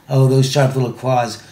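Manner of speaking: normal talking voice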